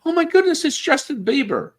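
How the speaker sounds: high, loud fangirl squeal; starstruck fan. Retold or imitated. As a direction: gush like a fan spotting a star. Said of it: "and they're like".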